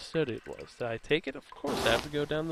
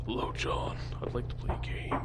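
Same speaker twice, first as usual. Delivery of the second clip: whispered sinister tape-villain voice; creepy whisper; 4 dB quieter than usual. Acted. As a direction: menace him under your breath. Reading the tape message.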